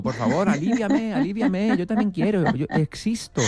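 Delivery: voz aguda y suplicante; fascia suplicante. Acted. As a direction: suplica como una vocecita desesperada. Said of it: "Pidiendo casi, suplicando". (vocecita aguda suplicando alivio)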